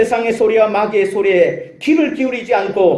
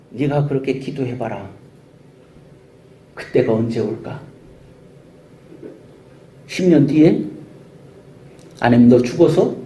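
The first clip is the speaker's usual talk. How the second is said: preacher voicing the devil who mocks delayed answers, lower and taunting; mocking devil; 4 semitones lower than usual. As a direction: sneer with long pauses. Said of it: "하면서 막 조롱 소리를 넣어요".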